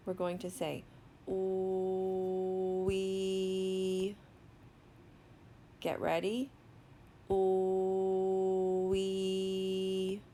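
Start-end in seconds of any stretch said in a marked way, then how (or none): 1.3-4.2 "Ooh, wee" stretched on one level pitch, unbroken; slow sounding-out. Shown as a demonstration. Teacher sounds out a word for learners, slowly. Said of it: "say the word slowly without stopping". 7.3-10.2 "Ooh, wee" stretched on one level pitch, unbroken; slow sounding-out. Shown as a demonstration. Teacher sounds out a word for learners, slowly. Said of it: "say the word slowly without stopping".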